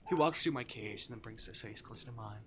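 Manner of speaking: usual speaking voice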